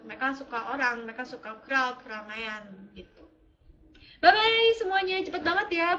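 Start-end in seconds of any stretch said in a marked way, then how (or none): 4.2-6.0 bye bye riang, nada tinggi; ceria pamit. Real, not acted. Pamit ke penonton dengan ceria. (pamit ceria ke penonton)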